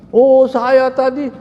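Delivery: high, loud, drawn-out mock eagerness; imitated eager storytelling. Rescued man starts telling what happened, mock excited. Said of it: "semangat dia cerita".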